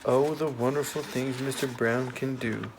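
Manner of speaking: plain reading voice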